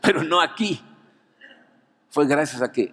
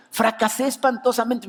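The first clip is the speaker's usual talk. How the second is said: dismayed protest; 10 semitones higher than usual. Retold or imitated. Speaker's own retold protest.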